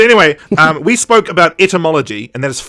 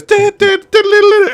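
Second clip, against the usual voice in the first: mock dramatic sting; 6 dB louder, slower than usual. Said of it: "And then it goes".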